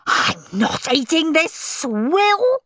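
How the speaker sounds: high, drawn-out shriek of disgust. A narrator's reading. Henry refusing the cheese sandwich, shrieking. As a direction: shriek slowly, swooping up on the last word. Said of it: "shrieked Henry".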